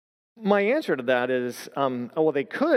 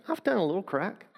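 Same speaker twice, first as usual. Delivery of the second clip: higher, small-voiced retold confession; sheepish admission; 6 semitones higher than usual. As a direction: admit it reluctantly, imitating a cornered man. Man reluctantly admits drug use.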